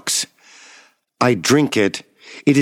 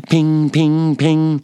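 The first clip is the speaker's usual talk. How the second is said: high, ringing "ping" sounds; imitated pinging; 6 semitones higher, much slower than usual. A narrator's reading. Patient echoing a pinging in the hospital stall, high imitation. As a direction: imitate a small high repeating alert tone. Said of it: "I hear a pinging".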